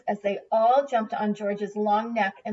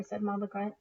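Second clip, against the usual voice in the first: plain reading voice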